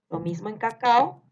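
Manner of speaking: ordinary talk, nothing marked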